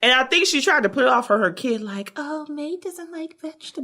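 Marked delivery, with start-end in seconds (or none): 2.2-3.8 soft, higher-pitched imitation of a mother; mock discreet murmur; hushed excuse. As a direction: say it under the breath as a sheepish excuse. Acts mother blaming her kid, hushed.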